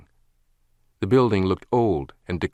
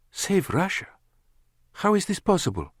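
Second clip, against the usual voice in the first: much higher, amazed woman's voice; astonished; 12 semitones higher than usual. A narrator's reading. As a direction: ask in disbelief. Lana astonished.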